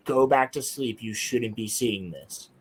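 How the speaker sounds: low, quiet robotic drone; eerie low computer voice; Tron voice. Retold or imitated. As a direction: intone it like a machine in your head. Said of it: "a voice that sounded like the voice from Tron".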